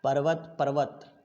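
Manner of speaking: ordinary talk, nothing marked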